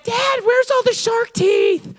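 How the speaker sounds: put-on high, slow child's plea; whiny kid. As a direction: ask like a disappointed little kid. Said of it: "their kids are like".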